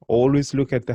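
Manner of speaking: ordinary talk, nothing marked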